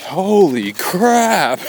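amazed. Retold or imitated. Father retelling son's awe at the huge fallen tree, drawn out. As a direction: stretch it out in laughing awe.

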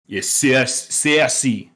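imitating Richard Madden's heavy Scottish accent on "Cersei"; strong Scottish accent. Imitating the actor's Scottish accent at its strongest. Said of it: "it got stronger in some instances".

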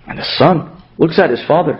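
usual speaking voice

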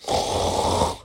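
imitated breathy pig grunt. A narrator's reading. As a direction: grunt like a piglet, all breath. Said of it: "when it grunted again".

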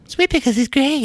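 high, bright commercial announcer voice; sing-song TV ad impression; ad jingle voice. Jingle voice for old ad.

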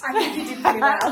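high, laughing thanks. Real, not acted. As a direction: laugh through the sign-off. Host laughs at the accent story.

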